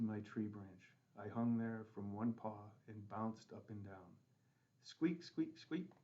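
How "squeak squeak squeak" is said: high squeaky monkey chatter; monkey squeaks. Pretend. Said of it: "I tried to sound like a monkey".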